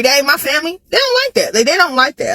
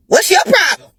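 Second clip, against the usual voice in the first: higher, shouted "what's your problem"; fed-up shout; 7 semitones higher than usual. Retold or imitated. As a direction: daughter yelling back at nagging mama. Annoyed at mama calling repeatedly.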